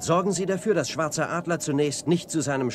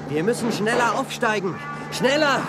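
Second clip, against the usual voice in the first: urgent shout; 10 semitones higher than usual. Acted. Jan urges faster climb while Indians close in, shouting. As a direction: call out over the rotor noise, urgent. Helicopter escape under attack.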